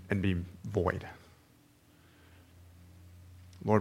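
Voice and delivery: soft, unhurried prayer voice, trailing off at line ends; opening prayer. Acted. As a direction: pray quietly and sincerely to God. Opening prayer before sermon.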